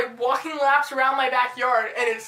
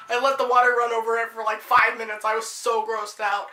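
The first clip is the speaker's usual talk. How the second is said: normal talking voice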